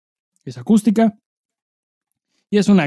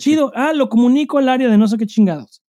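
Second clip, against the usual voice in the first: mock cheery agent; 6 semitones higher than usual. Mimicking the phone agent.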